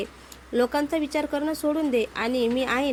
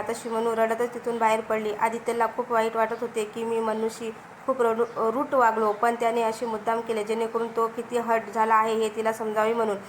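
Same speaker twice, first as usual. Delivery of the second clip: usual speaking voice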